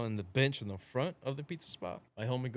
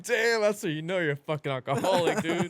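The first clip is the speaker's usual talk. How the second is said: laughing; 6 semitones higher, 5 dB louder than usual. Retold or imitated. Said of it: "I was like".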